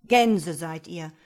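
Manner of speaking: loud, slow brotherly grumble; brummend. A narrator's reading. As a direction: grumble it at the sisters with force. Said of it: "brummte der Bruder". (laut und langsam brummender Bruder)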